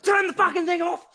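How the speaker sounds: high, shouted mock-mum outrage; irate mum. Mum yelling at her kid over the stream, shouting. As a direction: yell it like a mum at the end of her rope. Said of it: "And then this mum being like".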